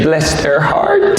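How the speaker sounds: slow sugary Southern drawl of the phrase; drawn out Southern put-down; Southern bless their heart. Retold or imitated. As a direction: stretch it out, sweetly. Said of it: "as long as you say".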